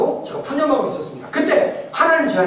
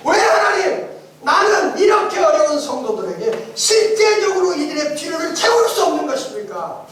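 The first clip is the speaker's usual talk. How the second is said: anguished cry to God; 6 semitones higher, 3 dB louder than usual. Retold or imitated. Crying out to God in lament.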